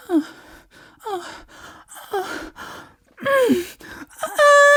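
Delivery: high squeaky moaning; ridiculous high-pitched moans; mock sex moans. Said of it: "her sex moan sounds like".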